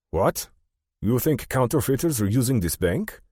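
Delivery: put-on high-pitched, incredulous German-accented banker; German accent, disbelieving. A narrator's reading. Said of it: "In a noticeable German accent".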